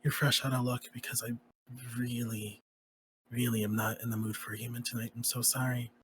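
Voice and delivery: soft, low murmur close to the ear; whispered rejection. Acted. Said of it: "lean over and whisper in his ear".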